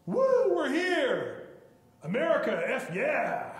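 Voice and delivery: loud, high whooping cheer; hammed-up triumphant shout; cheering astronauts. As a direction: whoop it like brash astronauts landing. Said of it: "like, go".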